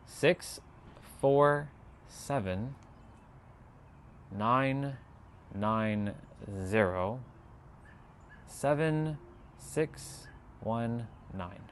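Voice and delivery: very slow, pausing after every digit. Shown as a demonstration. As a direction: dictate one number at a time, letting each land. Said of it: "I'll say it again".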